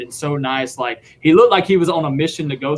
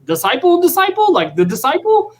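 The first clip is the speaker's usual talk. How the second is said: way higher and louder, shrieking double take; stunned disbelief; 14 semitones higher, 4 dB louder than usual. Retold or imitated. Sonny hearing the band's name on the bus, shrill shocked repeat. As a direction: play a rock star gobsmacked by a name. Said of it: "he's like".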